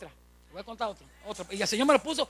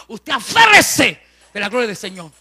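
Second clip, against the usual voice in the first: loud, slow, fervent cry; preaching shout; 13 dB louder than usual. Real, not acted. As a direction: drive it home from the pulpit. Predicador exhorta a los hermanos. (grito de predicador, lento y fuerte)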